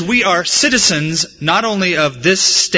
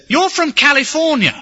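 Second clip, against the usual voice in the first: much higher-pitched shop owner punchline; shop owner's guess; 11 semitones higher than usual. Retold or imitated. Shop owner seeing through fake accent, high-pitched imitation. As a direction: pitch it way up as the unfooled accent-spotter. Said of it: "The guy said".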